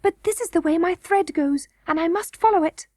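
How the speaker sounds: high-pitched insistent princess voice; mild girlish whisper. A narrator's reading. Princess must follow her thread, high and insistent. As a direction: insist as a young girl. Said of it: "whispered Irene".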